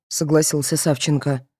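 ordinary delivery

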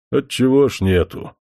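a touch of bristling protest. A narrator's reading. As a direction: forest spirit flares up, mildly indignant. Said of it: "как-то вскинулся Пафнутий".

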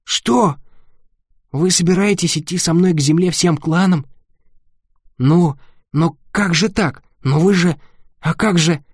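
ошеломлённо, медленно, севшим голосом; растерянно, не веря ушам; изумлённо. Acted. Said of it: "прохрипел Ник севшим голосом". (хрипло от изумления)

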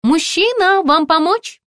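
very high, chirpy shop assistant; high-pitched, ringing sales voice; ringing saleswoman voice. A narrator's reading. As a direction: pipe up brightly through the door. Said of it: "спросил из-за двери звонкий голос".

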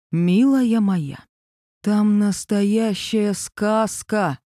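very slow, drawn-out gushing. Acted. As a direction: stretch every word with exaggerated sweetness. Arkasha gushing, eyes rolled.

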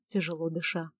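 ordinary delivery, nothing marked

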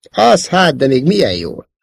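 high, loud comeback; sharp retort. A narrator's reading. Princess throwing Szóló's boast back at him, sharp retort. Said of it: "Vágott vissza a lány".

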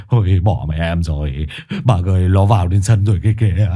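low, whimpering husband in terror; on the verge of tears. A narrator's reading. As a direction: wail and plead, close to crying. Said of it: "nói như sắp khóc". (mếu máo như sắp khóc)

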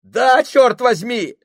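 high, loud, slow yell; shouting. Acted. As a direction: shout it out desperately. Sailor yells answer.

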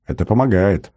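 ordinary delivery

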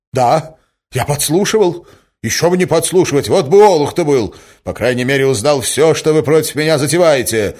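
loud, high, angry defiance; rude retort. A narrator's reading. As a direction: answer back roughly, goading yourself on. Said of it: "грубо отвечал Мозгляков". (грубо, с запальчивостью)

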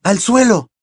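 high, sudden shout; shouted warning. A narrator's reading. Harry warning his friends as wands point at them, shouting. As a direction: shout it to save your friends. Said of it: "gritó".

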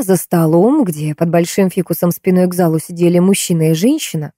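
usual speaking voice

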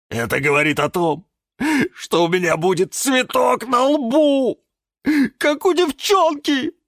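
high, tearful wail; sobbing. Acted. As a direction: wail in embarrassed distress. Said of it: "Не выдержал и всхлипнул кактус". (плаксиво, всхлипывая)

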